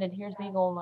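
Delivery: ordinary talk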